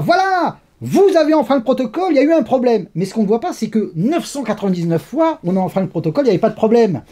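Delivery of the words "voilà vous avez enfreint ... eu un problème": high-pitched triumphant blame; mock accusing. Officials blaming him for a broken protocol, mock accusing.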